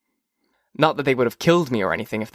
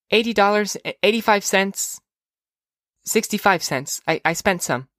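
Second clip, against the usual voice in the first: put-on high child's voice; tally aloud as a little girl; young girl; 8 semitones higher than usual. Sister telling brother the amount, high child voice. Child counting out her money.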